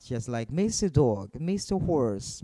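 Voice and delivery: plain reading voice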